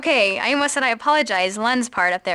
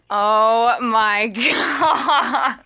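real laugh breaking through the words; slow words dissolving into laughter; cracking up; 3 semitones lower, much slower than usual. Real, not acted. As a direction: burst out laughing mid-phrase. Cracks up after flubbing lines.

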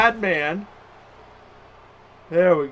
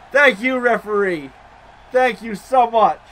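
slow, high, loud thanks; 6 semitones higher, 8 dB louder, slower than usual. Real, not acted. Player thanking the game's referee, loud drawn-out call. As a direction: call it out slowly to the official.

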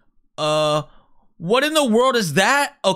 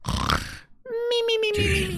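tiny high "me-me-me" snore; squeaky sleeping noises; cartoon snoring; 13 semitones higher, 4 dB quieter, much slower than usual. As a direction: play asleep with silly high-pitched snores. Falls asleep, cartoon snore.

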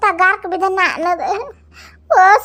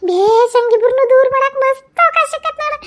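higher, gleeful villain aside; scheming glee; 8 semitones higher, 3 dB louder than usual. Acted. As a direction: gloat over the chance. Schemer gloating. (ಕುತಂತ್ರದ Santhosh)

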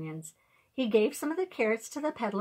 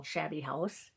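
ordinary delivery

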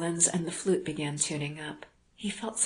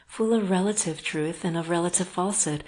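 usual speaking voice

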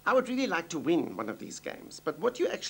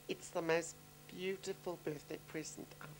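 ordinary delivery

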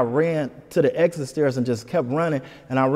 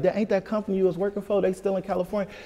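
higher-pitched take on a mother advising; imitating mom; 6 semitones higher than usual. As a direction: lightly voice mom talking it through, pitched up. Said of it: "She was like".

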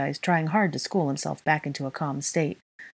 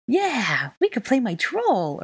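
put-on high, drawn-out childish delight; excited child; 8 semitones higher, 3 dB louder, slower than usual. A narrator's reading. Little child thrilled at playing with a toy, excited. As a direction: cheer eagerly in a small child's high voice. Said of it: "Aurelian said excitedly".

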